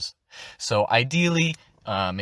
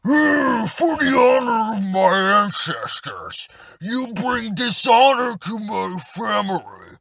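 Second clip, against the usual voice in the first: much higher, loud, slow battle-cry shout of a proud orc; orc warrior voice; 9 semitones higher, 4 dB louder, slower than usual. Shown as a demonstration. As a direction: bellow it like an orc warrior defending his family honor. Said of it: "altogether, it sounds a bit like this".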